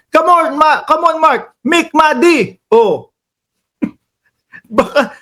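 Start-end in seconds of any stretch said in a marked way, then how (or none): 0.1-2.6 mock yell, pitched higher; a light jokey impression of a senator shouting; imitating Bato yelling. Mimicking the senator scolding him, mock yell. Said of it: "baka mamaya sigawan ako niyan".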